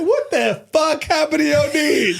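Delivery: high, mock-alarmed mother's voice; shocked mom. Mom pulling back the comforter, mock alarmed. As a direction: imitate a startled mom seeing the wounds. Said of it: "She said".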